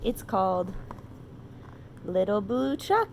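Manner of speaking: ordinary delivery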